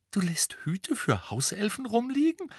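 high, incredulous rising question; slow disbelief. A narrator's reading. Ron questioning Hermine about elf hats, slowly incredulous. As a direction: spell it out in disbelief. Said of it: "sagte Ron langsam".

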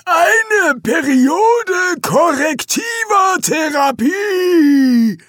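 high, very slow strained shout; choking out words. Acted. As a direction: force out each word as if gagging. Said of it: "schien jedes Wort herauszuwürgen".

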